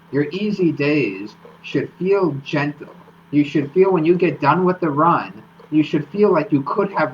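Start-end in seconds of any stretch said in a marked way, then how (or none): 0.1-3.0 gentle slowed and leaned on; stress on gentle. Shown as a demonstration. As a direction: lean into the word gentle, slowly. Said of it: "I emphasize that word gentle".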